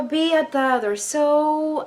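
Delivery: ordinary talk, nothing marked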